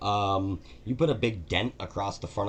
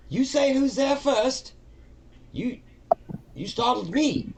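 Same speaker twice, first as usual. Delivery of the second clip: a much higher, slow, flustered little voice; startled stranger; 6 dB louder, slower than usual. Acted. Todd the cleaner startled behind the door, high flustered voice. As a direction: squeak it out like you just got a fright. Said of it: "voice from the other side says".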